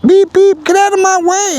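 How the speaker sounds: very high, loud squeaky honking voice; horn voice. Pretend. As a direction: beep like a tiny toy horn. Discovers the bike's horn.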